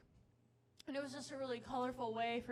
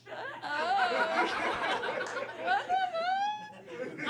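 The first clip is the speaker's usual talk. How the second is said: higher and louder, laughing disbelief; shocked outburst; 11 semitones higher, 7 dB louder than usual. Acted. Comic to crowd, retelling the nose compliment, in loud laughing shock. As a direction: blurt it out, stunned and laughing.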